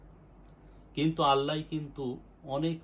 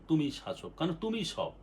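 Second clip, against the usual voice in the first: ordinary talk, nothing marked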